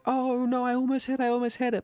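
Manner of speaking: high, whiny imitation of a near-miss loser; mock disappointment. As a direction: squeal it like you just barely lost. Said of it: "everyone else is like".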